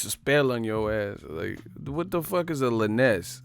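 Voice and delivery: ordinary delivery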